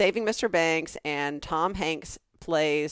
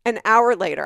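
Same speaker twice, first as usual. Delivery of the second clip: ordinary talk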